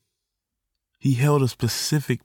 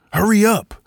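impatient call; 9 semitones higher, 5 dB louder than usual. Retold or imitated. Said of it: "the man was probably like".

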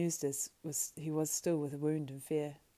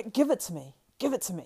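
loud, raised, urgent demand; sudden demanding cry; 5 semitones higher, 10 dB louder than usual. Acted. Frodo demands the Ring from Sam, crying out. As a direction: cry out as if seized by greed. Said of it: "his tone changed. ... he cried".

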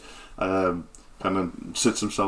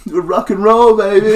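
higher, louder rocker sign-off; rock star bard; 12 semitones higher, 10 dB louder than usual. Acted. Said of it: "he's like".

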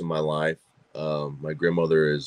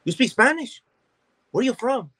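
much higher, quick caricature of people asking; imitating strangers asking, way up high; mock eager stranger; faster than usual. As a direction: squeak it out like a curious stranger. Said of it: "and just like".

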